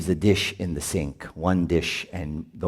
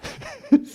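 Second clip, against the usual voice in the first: high burst of laughter. Real, not acted. Laughing at hating husband.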